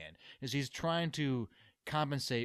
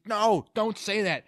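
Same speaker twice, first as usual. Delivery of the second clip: protesting; 6 semitones higher, 6 dB louder, slower than usual. Retold or imitated. Bruce protesting.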